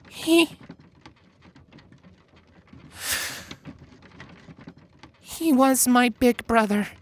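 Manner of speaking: high, shaky grieving voice; choked young mare voice. A narrator's reading. Twilight confessing, grieving.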